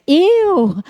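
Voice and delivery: high, loud startled question; incredulous. Retold or imitated. Churchgoer picked out by the pastor, incredulous. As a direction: act out exaggerated disbelief at being singled out. Acting out disbelief at being picked.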